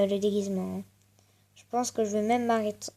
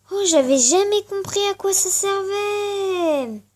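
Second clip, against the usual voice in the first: amazed; 10 semitones higher, 7 dB louder, slower than usual. Real, not acted. Amazed discovery in game.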